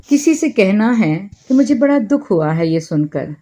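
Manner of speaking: usual speaking voice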